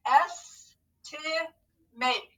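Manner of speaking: slow, syllable by syllable; scooping the word in parts; scooped syllables. Shown as a demonstration. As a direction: stretch out each part slowly. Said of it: "So scoop and read with me".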